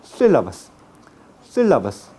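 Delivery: "sílabas" with the Castilian apico-alveolar s; tongue tip up for each s in "sílabas"; retracted, whistling Spanish s in "sílabas", falling tune; apical s in sílabas. Shown as a demonstration. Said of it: "¿cómo se pronuncia?".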